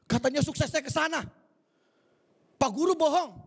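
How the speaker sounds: high, whining protest to God; a hurt believer whining that he was lied to; whiny complainer. Acted. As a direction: act the sulking believer who hit a wall. Acted believer complaining again.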